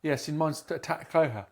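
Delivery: ordinary delivery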